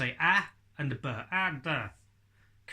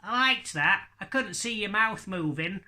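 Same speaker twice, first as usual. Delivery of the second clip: put-on higher, louder child voice; a child after the show, mimicked higher and louder; imitating a child; 7 semitones higher, 5 dB louder than usual. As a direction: play back the kid's delighted praise. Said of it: "they've come up to me after a show and went".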